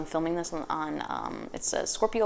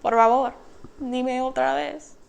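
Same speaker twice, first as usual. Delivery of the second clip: much higher, loud, mock-offended Spanish; sassy Spanish comeback; 7 semitones higher, 4 dB louder than usual. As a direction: flip into Spanish to make them repeat it. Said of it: "you're gonna be like".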